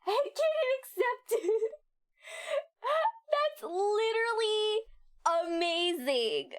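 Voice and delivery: laughing. Real, not acted. Breaking down laughing.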